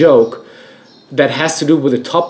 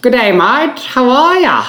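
rehearsing a higher-pitched Australian accent; 7 semitones higher than usual. Shown as a demonstration. [name] warming up his Australian accent for the podcast mates. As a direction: try out a cheerful Australian greeting. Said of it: "So I pretend to be an Australian now".

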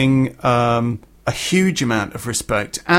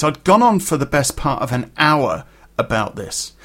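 ordinary talk

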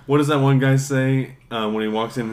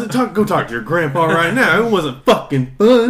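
raised, loud scolding; mock angry veteran; 5 semitones higher, 7 dB louder than usual. Veteran snapping at kid who wants war, loud mock anger. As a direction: bark it at the naive kid.

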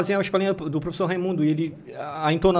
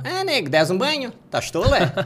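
higher, lilting islander questions; Santa Catarina islander accent; 7 semitones higher than usual. Retold or imitated. Showing the regional trait of islanders, lilting islander accent. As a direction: tease like a Florianópolis local. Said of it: "Eles falam bem desse jeito".

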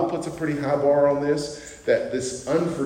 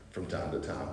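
"From time to time" dropped much lower and softer, wry; understated aside; 9 semitones lower, 13 dB quieter than usual. Shown as a demonstration. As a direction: throw it away under your breath. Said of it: "I'm understating it".

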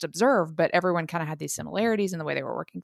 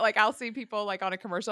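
ordinary delivery

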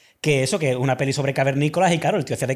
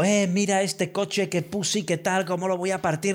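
mock shout; 4 semitones higher than usual. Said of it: "está gritando".

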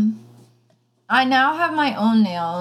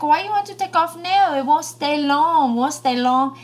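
mock broken-English nail tech, pitched up; imitating nail tech; 5 semitones higher than usual. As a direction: imitate the nail lady's accented English, higher. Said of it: "And then she was like".

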